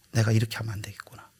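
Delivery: quick whispered inner voice; fast hushed self-talk; whispered thought. Retold or imitated. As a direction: whisper it as a private realization. Pastor's inner realization quoted.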